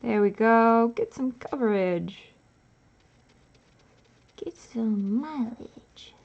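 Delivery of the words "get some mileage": slow breathy whisper. Shown as a demonstration. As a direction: whisper it slowly. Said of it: "repeats line whispered".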